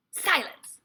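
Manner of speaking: very loud, high silencing command; commanding shout. A narrator's reading. Grand High Witch hushing the witches, a loud shout. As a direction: cut them off sharply. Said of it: "said the Grand High Witch".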